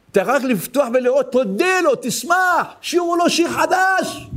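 high, ringing exhortation; prophet crying out. Retold or imitated. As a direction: call it out high like a prophet, drawing out the end. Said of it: "זה הנביא צועק". (קריאה נביאית בקול גבוה)

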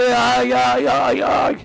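high repeated wail; mock crying. Son imitating half an hour of crying, high wails. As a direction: cry out in a string of imitated sobs. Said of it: "חצי שעה בוכה".

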